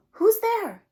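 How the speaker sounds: high, loud startled little mouse; mouse crying out. Acted. Mouse spotting glowing eyes in the dark, high startled cry. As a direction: squeak out in alarm. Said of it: "Mouse cries".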